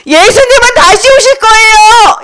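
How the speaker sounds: high, ringing proclamation; rousing street-preacher call; evangelist's cry. Acted. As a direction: announce it high to a whole crowd. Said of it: "이렇게 말했어".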